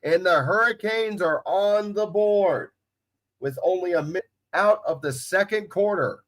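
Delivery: sports announcer recapping the play. Real, not acted. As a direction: announce the score with broadcaster's poise and lift. Announces the score and kick.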